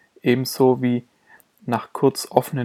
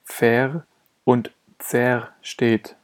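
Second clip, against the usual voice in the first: usual speaking voice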